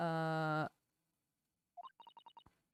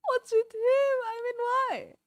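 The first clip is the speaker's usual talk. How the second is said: much higher, louder startled voice; high character voice; 5 dB louder than usual. Acted. Voicing the shocked assistant, high and loud. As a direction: pitch way up, baffled and loud.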